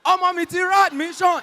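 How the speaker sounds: high, loud joyful cry of a mother; mother's overjoyed high-pitched exclamation; excited mother. Retold or imitated. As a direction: shriek with delight at the news. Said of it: "she was so excited".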